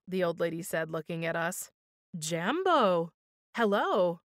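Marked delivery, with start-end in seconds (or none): 2.2-4.2 musical greeting. A narrator's reading. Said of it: "Her voice rose and fell like music".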